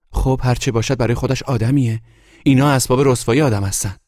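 high, loud indignant voice; heated arguing. A narrator's reading. Guest arguing over the scandalous couple, high and heated.

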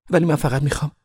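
high, protesting woman's voice; pleading reporter. A narrator's reading. Reporter protests as Ove goes indoors, high and pleading. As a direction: protest in a high voice, wanting a hearing.

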